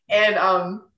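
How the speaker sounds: usual speaking voice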